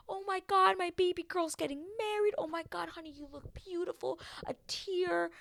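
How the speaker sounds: high-pitched mock-emotional parent; hammed-up tearful pride, without real crying; gushing dad. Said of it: "Literally no emotion. No, like".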